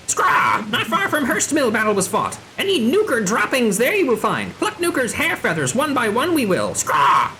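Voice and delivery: high, swooping squawky voice; outraged bird creature. Acted. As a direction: play a bird-person vowing payback.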